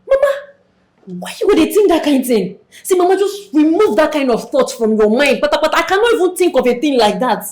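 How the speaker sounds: loud, high, vehement objection; alarmed protest. Acted. Daughter refuses her sick mother's talk of not living, vehemently protesting. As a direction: cry out against the very idea.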